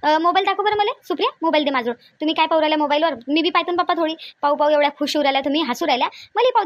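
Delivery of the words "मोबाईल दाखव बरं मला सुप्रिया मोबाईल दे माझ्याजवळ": normal talking voice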